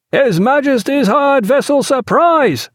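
high, slow shouted reply; formal, carrying sailor's call; answering hail. A narrator's reading. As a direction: shout the ship's name across the water.

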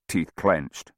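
ordinary delivery

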